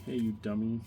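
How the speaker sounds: playful imitation of an annoyed viewer yelling; mock yelling at the screen. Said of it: "yelling at the".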